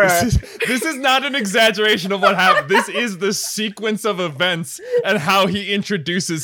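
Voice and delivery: can't stop chuckling as he insists it's true; laughing. Real, not acted. Host laughing while recounting scene.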